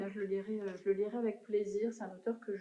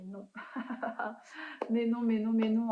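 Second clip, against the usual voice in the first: louder, laughing disbelief, repeating no; incredulous denial; 2 semitones higher, 3 dB louder than usual. Retold or imitated. Reader shocked by the book's twist ending, incredulous and louder. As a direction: refuse to believe the twist, half laughing.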